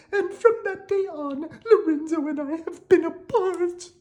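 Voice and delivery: very high, lamenting princess voice; a princess pouring out her sad tale. Acted. Princess tells her sad story.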